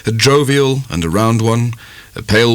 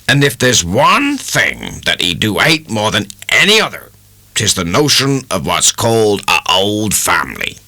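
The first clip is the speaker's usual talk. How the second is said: a plain-spoken countryman laying down the law; emphatic country dairyman; 3 semitones higher than usual. A narrator's reading. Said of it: "said the dairyman emphatically".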